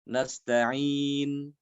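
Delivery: nasta'in ending on rounded lips with no voiced vowel; ismam ending. Shown as a demonstration. Teacher showing ismam, lips rounding at the end. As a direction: close nasta'in with the lips pursed, silent. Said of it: "dengan cara ismam".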